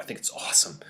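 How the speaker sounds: whispered confession, half to herself; a whispered aside that she secretly enjoys it; guilty whisper. Real, not acted. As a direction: let it slip out in a hush. Admits guilty delight in gore.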